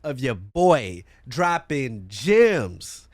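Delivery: slow, punchy podcast plug with dramatic pauses; hype ad read. Acted. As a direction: sell every word like a radio promo. Reads his own podcast plug.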